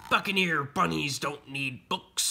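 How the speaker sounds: scornful pirate captain voice. Acted. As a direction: lay down the law as a pirate.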